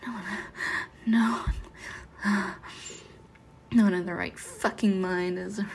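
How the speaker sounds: ordinary talk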